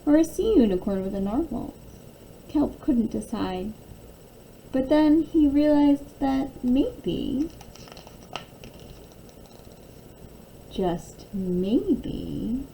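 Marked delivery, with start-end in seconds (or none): 4.7-12.7 slow, wondering build to a hush; dreamy realization. A narrator's reading. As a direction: let the thought dawn slowly, then soften.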